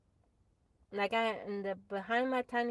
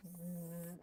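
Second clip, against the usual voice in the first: buzz; 2 semitones lower, 10 dB quieter than usual. Retold or imitated. Imitating the wasp buzz again.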